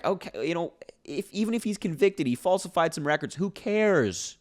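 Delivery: high, mocking dismissive voice; mocking shrug. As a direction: toss it off like it's nothing, in mockery. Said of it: "That's what they're saying".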